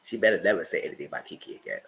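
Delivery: ordinary delivery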